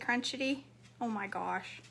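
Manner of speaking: ordinary delivery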